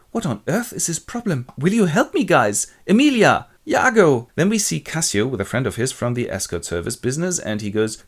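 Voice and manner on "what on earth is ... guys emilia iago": high, bewildered plea; flabbergasted. Acted. Wife left alone calls to friends, flabbergasted. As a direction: call out for help, baffled. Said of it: "totally flashed and flabbergasted and goes".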